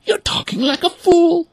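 very high, loud scolding duck voice; scolding mother duck. A narrator's reading. Mother duck scolding her son, high. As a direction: scold him sharply. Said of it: "the mother duck exclaimed".

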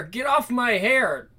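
ordinary delivery

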